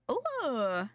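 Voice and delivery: ordinary delivery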